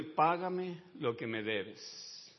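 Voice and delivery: a calm slow reading of the demand; slow quoted demand. Acted. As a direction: read out slowly and evenly.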